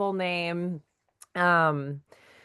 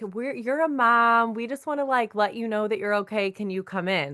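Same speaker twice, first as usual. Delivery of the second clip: imitating doctor's office caller; 5 semitones higher than usual. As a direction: play the caller gently reassuring a new mom. Doctor's office calling, voiced.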